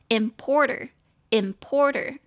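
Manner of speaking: "importer" stressed on POR; slow "importer", middle syllable drawn out and strong; stress on second syllable. Shown as a demonstration. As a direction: say "importer" slowly as im-POR-ter. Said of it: "重音节在第二个音节".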